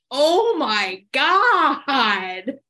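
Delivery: crying with laughter at the story; laughing so hard crying. Real, not acted. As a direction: lose it completely, laughing to tears. Hearing the toothbrush revenge story.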